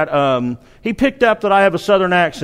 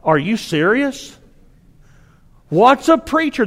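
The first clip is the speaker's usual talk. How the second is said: can't believe what he just heard; incredulous; 6 semitones higher than usual. Retold or imitated. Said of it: "And I was like".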